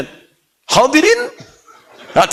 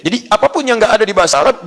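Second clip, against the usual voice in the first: usual speaking voice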